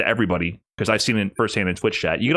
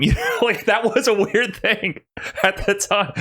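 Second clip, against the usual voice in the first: high giggly laugh through words; 10 semitones higher than usual. Real, not acted. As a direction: laugh while you talk. Laughing while recalling jarring commentary.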